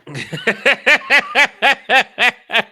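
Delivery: loud, high staccato cackle; high-pitched sarcastic laughter. Real, not acted. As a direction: let out a long, mocking machine-gun laugh. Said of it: "sarcastic laugh".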